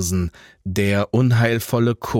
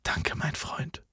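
very soft, slow whispered thanks; heartfelt whisper; 4 semitones higher, 12 dB quieter, slower than usual. A narrator's reading. Jan grips Franz's shoulders, heartfelt whisper. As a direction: whisper it slowly to a friend. Thanking friend who expects death.

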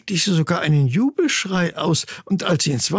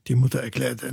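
ordinary delivery

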